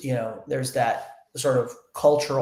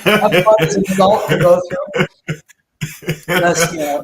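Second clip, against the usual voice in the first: 1 laughing joke; 4 semitones higher, 9 dB louder than usual. Real, not acted. Joking about adult circumcision.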